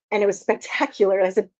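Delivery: ordinary talk, nothing marked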